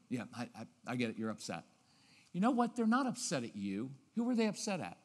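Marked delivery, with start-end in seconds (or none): 0.1-1.6 soft, low and resigned; lower, softer and accepting. Shown as a demonstration. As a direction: calmly let them be upset. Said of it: "that resigned tone is really, really good".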